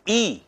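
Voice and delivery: ई held as a high long ee to set the tongue; long ee demo. Shown as a demonstration. Teacher shows students where ई places the tongue. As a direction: sing out ई to find the tongue spot. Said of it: "ई में जीभ जहां जाएगी".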